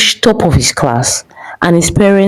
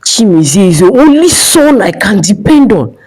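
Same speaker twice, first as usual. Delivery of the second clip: mad father shouting, pitch climbing high; angry father's shout; 6 semitones higher than usual. A narrator's reading. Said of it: "he shouted at Emeka".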